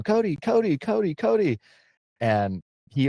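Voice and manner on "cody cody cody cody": high mock chant of a name; imitating a child yelling. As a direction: call out high like an excited kid. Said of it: "she's yelling".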